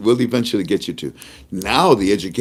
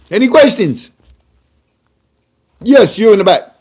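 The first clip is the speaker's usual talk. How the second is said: high, loud put-on official at the microphone; mock governor voice; 13 semitones higher, 8 dB louder than usual. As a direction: play the pompous bank governor addressing citizens. Impersonates the bank governor at mic.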